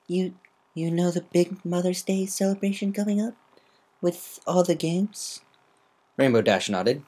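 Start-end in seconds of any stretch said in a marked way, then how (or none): 0.1-5.4 high, hesitant filly voice; nervous stammer, slowing down. Acted. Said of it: "her heart racing".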